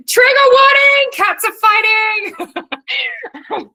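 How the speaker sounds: very high, loud, stretched-out joking alarm call; mock alarm announcement. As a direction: ham it up like a siren warning. Joking about noisy fighting cats.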